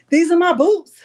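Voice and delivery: high and loud, proud of her boots; almost shouting with delight; excited showing off. Real, not acted.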